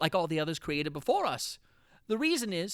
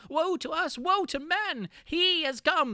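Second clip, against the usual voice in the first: higher, rising alarm; terrified outcry; 8 semitones higher, 4 dB louder, slower than usual. Acted. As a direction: cry out the doom. Narrator's terror at the creature.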